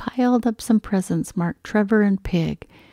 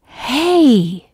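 unkind shout; 6 semitones higher, 7 dB louder than usual. A narrator's reading. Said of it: "shouted Pig".

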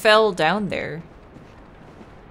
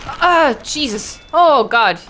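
startled yelp; 5 semitones higher, 10 dB louder, slower than usual. Real, not acted. Jumpscare in game.

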